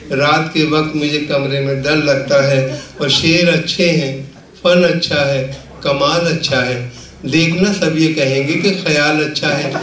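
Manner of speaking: measured, projected Urdu verse recital; comic poet reciting with rhythmic phrasing; mushaira recitation. Acted. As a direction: declaim the couplets to a live audience, line by line.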